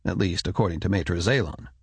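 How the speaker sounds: ordinary delivery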